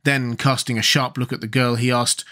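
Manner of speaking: ordinary delivery, nothing marked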